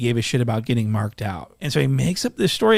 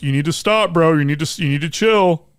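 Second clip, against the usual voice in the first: higher-pitched mock plea; mock pleading; 5 semitones higher, 5 dB louder than usual. Narrator mimicking the scared sixteen telling him to chill, mock pleading. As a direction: imitate a rattled man begging someone to back off.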